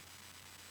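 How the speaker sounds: quick soft intake of breath; shocked gasp. Acted. Storyteller reacting to the old man, shocked gasp.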